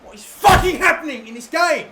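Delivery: real exasperated yell, high and loud; fed-up gamer shouting; angry outburst. Real, not acted. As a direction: vent the fury at the game. Raging at the game.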